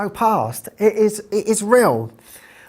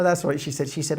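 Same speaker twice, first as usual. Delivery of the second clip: usual speaking voice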